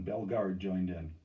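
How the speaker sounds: ordinary delivery, nothing marked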